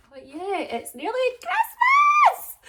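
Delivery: high, loud, slow festive cheer; excited shout. Real, not acted. Vlogger announcing Christmas to followers, shouting excitedly. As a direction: belt it out with glee.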